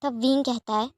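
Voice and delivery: usual speaking voice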